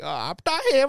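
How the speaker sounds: put-on high, loud burst of Indian accent; strong Indian accent. Kids talking to their parents, super strong Indian accent. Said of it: "talk, like, a super strong".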